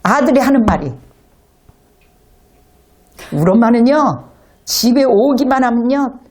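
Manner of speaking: normal talking voice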